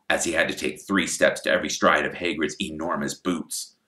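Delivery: plain reading voice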